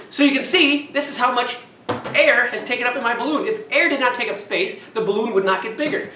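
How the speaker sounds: ordinary talk, nothing marked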